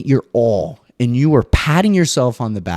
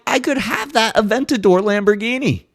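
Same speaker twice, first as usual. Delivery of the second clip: covetous daydream; 12 semitones higher than usual. Retold or imitated. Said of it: "Meanwhile, I'm like".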